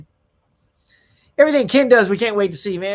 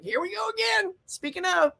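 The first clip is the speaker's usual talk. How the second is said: much higher, held-up comic pitch; mock high voice; 12 semitones higher, 3 dB quieter than usual. Mock cry put in his own mouth.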